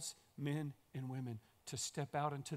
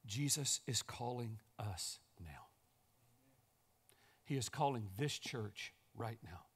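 hushed appeal; 6 semitones lower, 5 dB quieter than usual. Real, not acted. Preacher's intimate appeal.

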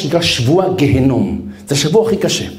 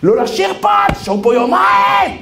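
officer shouting down singing soldiers; commander's yell; 14 semitones higher than usual. Retold or imitated. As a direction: bark an order to stop singing. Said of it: "המפקדים התחילו לצעוק".

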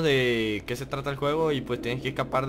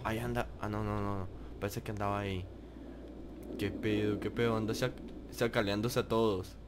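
much softer, low hushed voice; 5 semitones lower, 10 dB quieter than usual. Real, not acted. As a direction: keep it hushed, hiding. Hiding from the witch.

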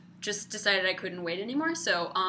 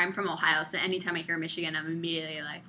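ordinary talk

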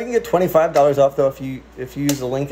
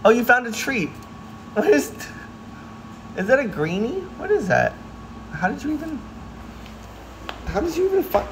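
surprised pet talk; 9 semitones higher, 5 dB quieter than usual. Real, not acted. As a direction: marvel at the cat's find in a high, cooing voice. Cat finds a treat.

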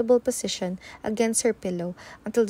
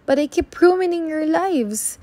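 higher, louder, on the edge of tears; anguished protest; 8 semitones higher, 5 dB louder than usual. A narrator's reading. Sophie tells her parents she ruins their lives, anguished and high. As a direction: cry it out as if you're breaking.

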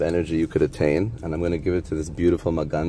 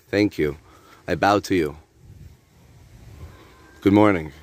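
morning prayer; 3 semitones higher, 4 dB louder than usual. Real, not acted. Thanking God and the deities on waking, declaimed morning prayer. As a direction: proclaim thanks to God, slightly raised voice.

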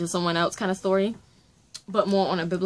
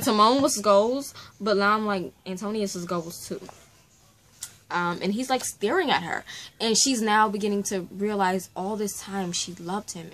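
normal talking voice

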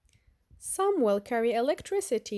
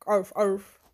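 playful mock bark, louder; toy dog bark; 3 semitones lower, 4 dB louder than usual. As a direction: bark it like a little robot dog. Child shows robot dog barking.

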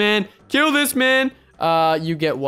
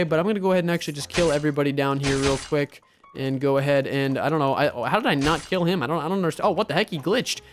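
ordinary talk, nothing marked

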